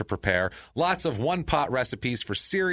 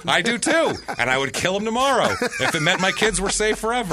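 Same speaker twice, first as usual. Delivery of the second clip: laughing outburst; 10 semitones higher, 5 dB louder than usual. Real, not acted. Heated dog argument, laughing.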